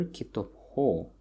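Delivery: kitop ho with the stress on ho; stress on suffix. Shown as a demonstration. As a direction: land heavily on the ho of kitop ho. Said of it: "the stress falls on the".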